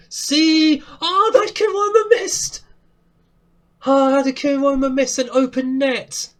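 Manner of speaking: high, loud, thrilled outcry at a near goal; excited shouting. Real, not acted. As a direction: shout it out in disbelief. Chance missed, excited commentary.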